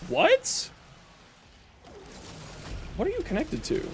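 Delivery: high, startled questions; surprised. Real, not acted. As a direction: caught off guard. Surprised at a friend's setup.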